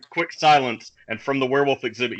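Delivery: ordinary delivery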